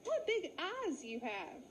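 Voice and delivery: high startled sheep; gasping surprise. Acted. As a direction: blurt it out in shock. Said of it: "Trudy Ooh suddenly gasped".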